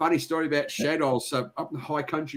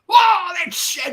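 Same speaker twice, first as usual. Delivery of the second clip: high, loud, drawn-out cry of recognition; re-enacted excited shout; 7 dB louder, much slower than usual. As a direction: call it out slowly, thrilled to spot someone you know. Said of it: "And I got so excited".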